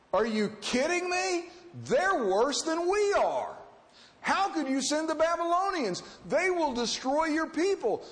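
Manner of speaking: prophet's protest. Acted. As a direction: push back at God in disbelief.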